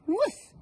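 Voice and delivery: very high yappy woof; high yapping woof; dog bark. Acted. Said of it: "said the dog".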